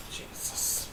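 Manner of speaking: breathless whispered Jesus. Real, not acted. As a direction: whisper it under your breath. Shocked aside about Les Mis.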